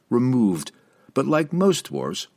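ordinary delivery, nothing marked

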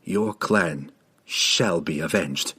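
low, slow solemn reassurance; comforting promise. A narrator's reading. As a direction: promise it gently and gravely. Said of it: "he said comfortingly".

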